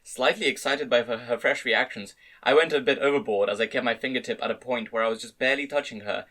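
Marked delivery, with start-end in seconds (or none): none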